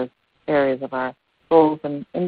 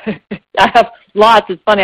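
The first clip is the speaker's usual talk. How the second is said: laughing; 5 semitones higher, 9 dB louder, slower than usual. Real, not acted. Laughing reply to host's praise.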